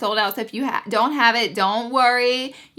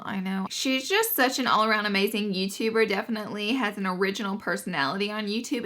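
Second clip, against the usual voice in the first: ordinary talk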